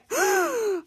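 high, drawn-out shocked gasp; mock gasp. Volt reacting to the blame, shocked gasp.

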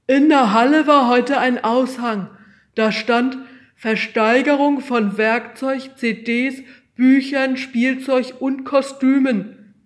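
high, loud, slow and insistent; eager boy voice. A narrator's reading. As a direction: keep pushing the idea at the teacher. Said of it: "fuhr Pekka trotzdem fort".

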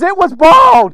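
loud, high wail of dismay; crying out. Acted. Prophet lamenting the lost axe head, crying out. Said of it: "The guy's crying out".

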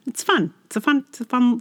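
usual speaking voice

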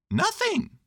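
bright little bear asking kindly; high cheerful Pooh Bear; cheery Pooh. A narrator's reading. Pooh's voice.

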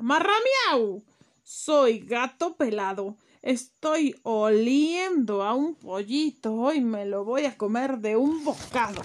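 loud, slow meow, then threats; menacing cat. Acted. Cat threatening the hidden chick, loud. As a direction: growl out a spooky cat's threat.

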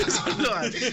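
laughing out the slogan. Retold or imitated. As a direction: giggle through it. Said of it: "como Nike".

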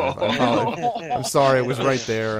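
helpless giggling while speaking; laughing apology. Real, not acted. Cracking up over a pun.

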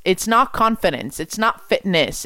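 normal talking voice

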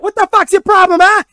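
high, loud hammed-up dad tirade; mock yelling dad. Dad yelling at his son on the field, mock furious. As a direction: bellow like Bill Burr chewing out his son. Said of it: "Yell at his son like that".

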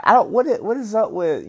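incredulous. Real, not acted. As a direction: ask it like you can't believe it. Baffled rant about casseroles.

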